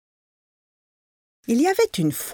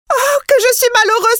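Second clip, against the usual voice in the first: high, loud wail of misery; anguished cry; 13 semitones higher, 6 dB louder, faster than usual. A narrator's reading. Wife with the sausage on her nose, crying out high and loud. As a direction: cry out in despair. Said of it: "s'écria-t-elle".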